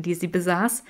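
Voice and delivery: usual speaking voice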